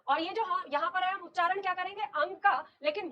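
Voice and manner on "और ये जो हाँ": ordinary delivery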